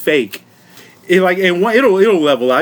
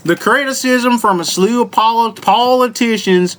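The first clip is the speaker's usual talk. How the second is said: put-on Southern accent; 4 semitones higher than usual. Host reads the article about critics, put-on Southern accent. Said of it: "read this whole article in this voice".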